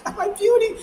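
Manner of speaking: high-pitched dramatic lament; hammed-up wailing over lost beauty supplies; mock distress. Acts out panic over beauty products.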